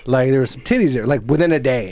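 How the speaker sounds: normal talking voice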